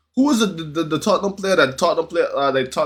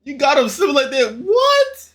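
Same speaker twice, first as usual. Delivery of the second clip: excited; 13 semitones higher than usual. Real, not acted. Reactor mocks the slipping line.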